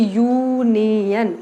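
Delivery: Union drawn out very slowly, starting on yu; slow sound-out. Shown as a demonstration. Teacher shows how to write it in Hindi, slowly. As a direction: stretch union syllable by syllable. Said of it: "Hindi में लिखूँ तो कैसे".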